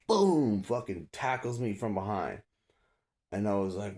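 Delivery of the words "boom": loud, high boom; imitated impact. Storyteller sounding the tackle from behind, loud impact. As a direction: punch out the crash of a tackle.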